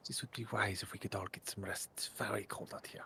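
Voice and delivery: very soft, voiceless whisper; hushed in-character whisper. Acted. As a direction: whisper it to companions close by. Character murmurs amid resting group.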